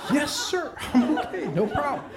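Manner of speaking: put-on high-pitched little boy reporting in; obedient son. Son trying to impress his sergeant dad, high obedient voice. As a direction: answer up high, like a dutiful small cadet.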